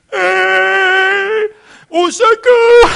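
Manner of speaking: appel au secours crié, beaucoup plus aigu, Au secours étiré lentement; grosse voix mâle. Retold or imitated. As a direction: crier à l'aide depuis le bateau en panne. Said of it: "sortir ma grosse voix mâle". (appel au secours crié, très aigu et lent)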